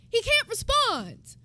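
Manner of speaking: loud, high and drawn out; exasperated outburst. Real, not acted. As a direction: burst out in drawn-out disbelief. Exasperated retort to quoted "you good?.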